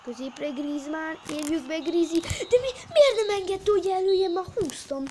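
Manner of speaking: high, loud live play-by-play; excited hungarian sports commentary. Acted. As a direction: commentate breathlessly. Live commentary then complaint.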